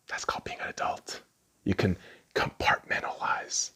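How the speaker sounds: soft whisper, as if confiding; whispered aside. Real, not acted. Vlogger whispering a grown-up lesson to the kids. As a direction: lean in and whisper it.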